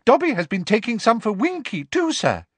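very high, cheerful squeaky elf; cheerful squeaky house elf; happily. Acted. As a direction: chirp it happily like a little elf. Dobby speaking.

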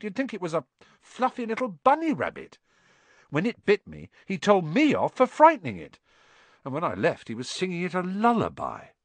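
high-pitched, incredulous rant; exasperated. Acted. Ron telling friends how Hagrid dotes, exasperated.

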